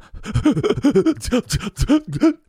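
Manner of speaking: long high giggling fit; giddy high laugh. Retold or imitated. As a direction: laugh helplessly, high and drawn out. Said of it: "and I'm going".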